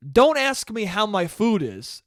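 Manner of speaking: usual speaking voice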